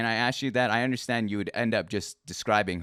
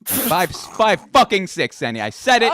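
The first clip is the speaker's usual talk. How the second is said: loud admission; 9 semitones higher, 11 dB louder than usual. Real, not acted. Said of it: "Say it".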